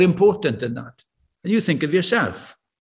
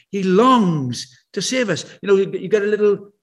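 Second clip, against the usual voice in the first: usual speaking voice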